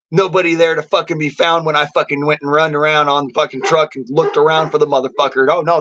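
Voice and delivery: ordinary delivery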